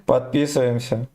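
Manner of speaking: ordinary talk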